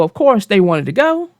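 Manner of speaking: ordinary talk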